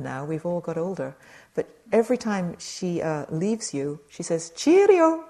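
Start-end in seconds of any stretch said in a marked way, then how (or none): 4.5-5.2 high, loud Scottish sing-out; imitated Scottish farewell, pitched high and loud; Scottish accent. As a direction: call it out brightly like a Scottish friend leaving. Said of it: "A Scottish accent".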